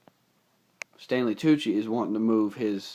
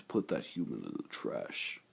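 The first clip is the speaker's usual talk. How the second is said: much lower, quieter villain order; cold robot villain; 10 semitones lower, 9 dB quieter than usual. Acted. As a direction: dismiss the human with cold contempt. Voicing Lockdown's order.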